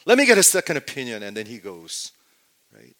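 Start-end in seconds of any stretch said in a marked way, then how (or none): 0.1-1.2 fast, loud, high demand; infuriated. Acted. Pretend patient Kevin demands a second opinion, infuriated. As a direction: fire it back at the doctor angrily. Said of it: "He gets infuriated, mad at me".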